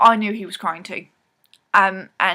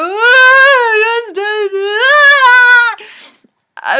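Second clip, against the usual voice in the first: mock sobbing wail, much higher and louder; imitating heavy sobs; 14 semitones higher, 13 dB louder, much slower than usual. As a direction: ham up the cinema sobbing. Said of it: "really heavy sobs".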